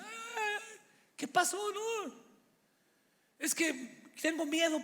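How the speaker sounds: high, frightened voice of the sister; scared sister. Retold or imitated. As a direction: squeal it like a terrified sister. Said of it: "Y decía".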